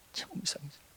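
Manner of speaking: very soft, almost whispered echo; barely voiced aside; hushed repeat. Real, not acted. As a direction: drop to a hush for suspense. Hushed repeat of strange sensation.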